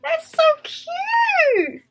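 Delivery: slow, high, drawn-out squeal; gushing delight. Real, not acted. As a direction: coo it slowly, pitched right up. Gushing over a gift.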